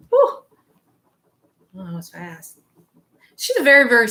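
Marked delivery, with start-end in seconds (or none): 0.1-0.4 a sharp, very high, loud whew. Retold or imitated. Spirit's exclamation repeated. 1.8-2.6 very soft, awed murmur; hushed amazement. Retold or imitated. Spirit's words repeated.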